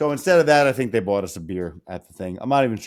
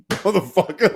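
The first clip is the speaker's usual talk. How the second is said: mock exasperation; 9 semitones higher, 4 dB louder than usual. Reacting to opponent's bad memory.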